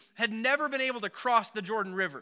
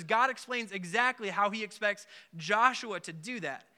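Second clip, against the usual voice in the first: usual speaking voice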